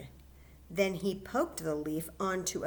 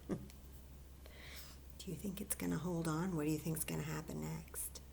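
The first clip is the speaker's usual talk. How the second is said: whisper; 3 semitones lower, 9 dB quieter than usual. Real, not acted. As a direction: whisper it like a secret. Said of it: "teacher whispers to the kids".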